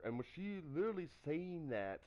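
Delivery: ordinary talk, nothing marked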